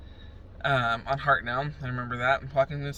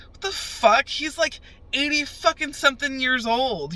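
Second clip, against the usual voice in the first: much higher, louder disbelief; shocked outburst; 11 semitones higher, 5 dB louder than usual. Retold or imitated. Hearing her grandpa was stabbed, high shocked outburst. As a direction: blurt it out in stunned disbelief.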